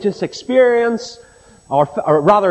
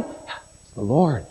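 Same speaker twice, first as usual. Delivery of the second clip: very low, slow, soft confirmation; hushed awe; 9 semitones lower, 7 dB quieter, much slower than usual. Retold or imitated. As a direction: let it sink in, almost under the breath. Acted answer confirming the astonishing news.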